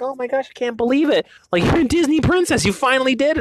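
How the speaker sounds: a retold squeal of disbelief; imitating wife's excitement. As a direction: play the starstruck wife, lightly. Wife's awed reaction, retold.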